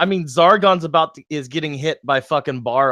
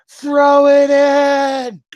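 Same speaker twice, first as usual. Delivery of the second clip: high, loud, long-held scream; Frodo screaming; 10 semitones higher, 8 dB louder, much slower than usual. Acted. Ymir urging the horn be destroyed, drawn-out high scream. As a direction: scream it out slowly in desperate urging. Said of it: "Ymir's Frodo screaming".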